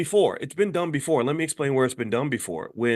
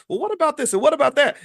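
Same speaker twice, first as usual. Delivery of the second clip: high-pitched rapid-fire interviewer; mock insistent questioning; imitating Charlemagne; 12 semitones higher, 5 dB louder, faster than usual. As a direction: pepper him with pushy questions. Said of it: "Charlemagne's asking all these questions".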